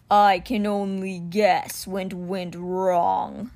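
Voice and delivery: very slow, drawn-out gargled words; gargled cheetah voice. A narrator's reading. Stone cheetah surfaces and speaks to the boy, slow gargle. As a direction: gargle it out as a stone big cat. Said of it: "Simba gargled".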